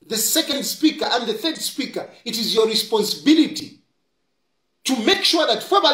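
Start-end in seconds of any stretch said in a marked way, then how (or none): none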